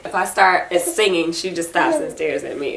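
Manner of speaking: ordinary talk, nothing marked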